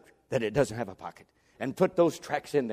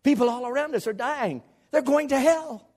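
high-pitched pulpit cry; urgent preaching; 12 semitones higher, 4 dB louder than usual. Real, not acted. Preacher warning congregation of the dying, urgent and high.

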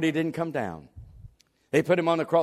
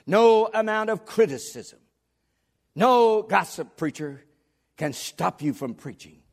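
raised, emphatic pulpit voice; full-force preaching; 6 semitones higher, 6 dB louder, slower than usual. Real, not acted. Preacher defying his critics, emphatic proclamation. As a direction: stretch and hit each "no".

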